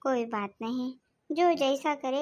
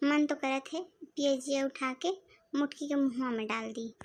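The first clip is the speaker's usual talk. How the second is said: ordinary talk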